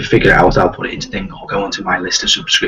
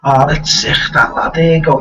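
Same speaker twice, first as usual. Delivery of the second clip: exaggerated Liverpool accent, a bit louder; proper Scouse accent; 6 semitones higher, 4 dB louder than usual. Shown as a demonstration. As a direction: lay on thick, lively Scouse. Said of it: "my proper Scouse accent".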